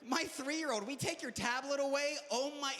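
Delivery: ordinary delivery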